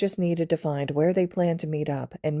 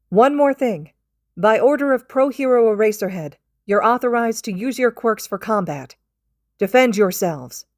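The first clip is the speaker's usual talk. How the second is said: higher and louder, an urgent camp-wide warning; urgent announcement; 6 semitones higher, 7 dB louder than usual. Acted. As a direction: call it out to all students like an alarm.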